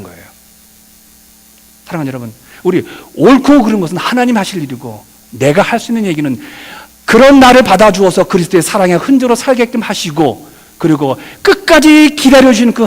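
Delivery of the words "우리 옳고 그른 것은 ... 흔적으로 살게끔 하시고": ordinary talk, nothing marked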